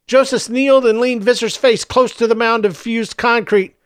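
plain reading voice

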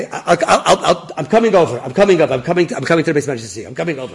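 loud, high, rushed caller; excited. Retold or imitated. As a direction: blurt it out in a hurry. Frantic caller on the phone.

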